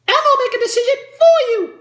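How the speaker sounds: very high, loud puppet voice; high-pitched puppet shout breaking into a cackle; Elmo. Acted. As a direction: squeal it like a gleeful little puppet. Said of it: "puppets like Elmo and Big Bird".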